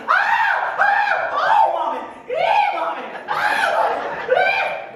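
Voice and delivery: very high, loud screaming with laughter in it; panicked falling scream. Acted. Baby eagle falling from mama's back, screaming very high. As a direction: scream as you drop through the sky.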